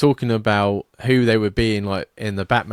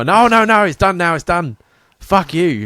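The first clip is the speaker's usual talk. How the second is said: high, loud indignant protest; abrupt refusal; 8 semitones higher, 8 dB louder than usual. Acted. Alfred walking out on Batman, loud abrupt refusal. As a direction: cut him off, loud and fed up. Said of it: "really abrupt as well".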